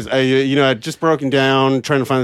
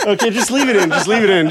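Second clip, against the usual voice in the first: laughing; 7 semitones higher than usual. Real, not acted. Laughing, deciding to keep clip.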